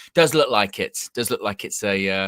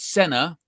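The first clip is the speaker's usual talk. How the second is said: Senna with SEN pitched up; stress on first syllable; 7 semitones higher, 5 dB louder than usual. Shown as a demonstration. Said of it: "emphasis on the first syllable".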